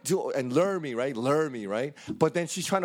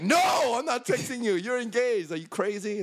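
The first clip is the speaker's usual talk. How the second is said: retold refusal shout; 8 semitones higher than usual. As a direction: shout him off, outraged. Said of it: "I was like".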